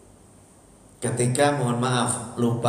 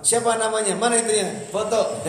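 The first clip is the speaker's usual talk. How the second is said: eager officer; 10 semitones higher than usual. Retold or imitated.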